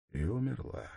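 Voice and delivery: ordinary delivery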